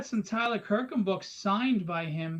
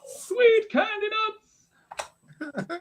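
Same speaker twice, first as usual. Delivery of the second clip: excited shout; 6 dB louder, slower than usual. Real, not acted. Excited reaction to scan.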